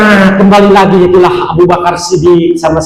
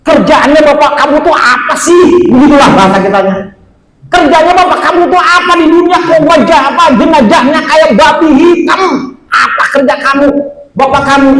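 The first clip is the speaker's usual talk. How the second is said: urgent questioning; 6 semitones higher than usual. Acted. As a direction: demand the answer with alarm. Prophet questions the young man.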